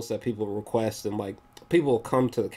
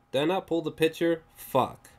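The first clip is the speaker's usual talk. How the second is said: ordinary delivery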